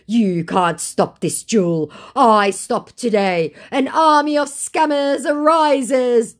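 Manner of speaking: slow, high, hammed-up menace; mock scammer threat. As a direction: play the scammer's grand threat.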